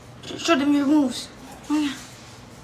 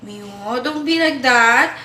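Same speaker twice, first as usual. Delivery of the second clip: scolding; 7 dB louder than usual. Real, not acted. Scolding the cat.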